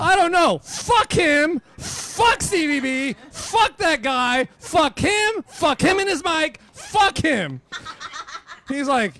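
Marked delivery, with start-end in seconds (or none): 0.0-7.6 loud, higher angry rant at the bartender. Retold or imitated. DJ ranting to the bartender, loud angry venting. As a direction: vent every curse, building to a laugh.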